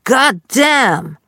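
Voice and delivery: loud, high outburst; high-pitched angry-dad holler; loud cursing. A narrator's reading. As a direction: yell the curse like Dad. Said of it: "hörte ich ein lautes".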